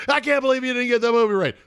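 high, fast, mocking listener voice; mock angry listener. Host mimics listeners yelling at radios, mock outrage. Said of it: "People are screaming at their".

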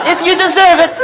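amused rabbi's blessing, high and loud with laughter; laughing retold rabbi. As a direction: relish the rabbi's punchline while laughing. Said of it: "He says".

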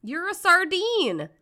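teasing singsong. Pretend. Teasing joke on Sardinian.

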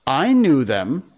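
high, drawn-out claim; doubtful. A narrator's reading. Michael copies John's boast to the others, doubtful. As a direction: stretch it out, not quite sure. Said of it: "Michael said rather doubtfully".

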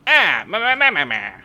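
high, loud nonsense babble; gibberish yapping. Retold or imitated. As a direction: babble like Simlish characters. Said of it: "They're just going".

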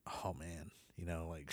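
soft, hushed and unsure; hushed worry. Retold or imitated. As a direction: murmur it like you don't want to be heard. Hushed worried quote of friends.

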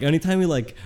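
ordinary talk

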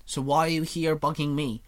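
ordinary delivery

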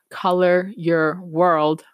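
put-on slow, word by word, like a child sounding it out; slow reading aloud. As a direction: read "Color your world" slowly, each word separate. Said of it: "I said it like that because I was reading it".

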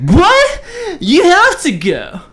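high, loud, slow outraged mother; shocked mom. Retold or imitated. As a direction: shriek it like an appalled mom. Mom insisting on prom.

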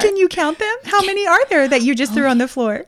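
high sing-song parent parody; hammed-up teaching-mom voice; mock doting mom. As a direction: quiz the child with exaggerated cheer. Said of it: "very different than Brooklyn's mom".

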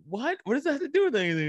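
high, fast indignant squeal; baffled outrage. Retold or imitated. Debate rival reacting to an insult, mock high outrage. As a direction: squeak it out, hammed-up disbelief. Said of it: "And he'd be like".

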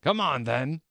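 high, loud royal roar; loud, high queen's summons. A narrator's reading. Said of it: "roared the queen".

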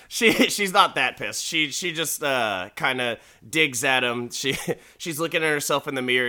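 usual speaking voice

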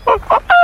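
high squeaky bird call, varied rhythm; bird call imitation. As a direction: chirp it like the bird. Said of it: "as opposed to a".

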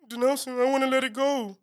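high-pitched little whimper of a plea; mock-pitiful high voice; sad, scared pleading. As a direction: imitate a frightened, pitiful brother. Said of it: "just so sad and scared".

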